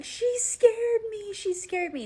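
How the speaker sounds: high, loud imitation of a frightened toddler; mock toddler cry of fright; retold scared crying. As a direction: wail it like a shaken little boy. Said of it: "crying, he's pointing, and he's like".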